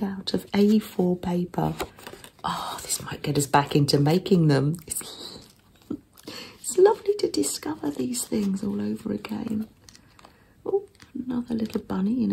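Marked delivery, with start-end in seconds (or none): 2.4-9.7 ASMR whisper. Real, not acted. Showing a handmade zine to viewers in a gentle whisper. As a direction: hush every word for the listener. ASMR journal flip-through.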